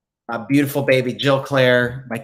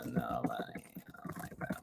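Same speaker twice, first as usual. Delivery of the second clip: breathy unvoiced whisper to self, much softer; 14 semitones lower, 16 dB quieter, slower than usual. Real, not acted. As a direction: breathe it out under your breath while typing. Muttering while typing.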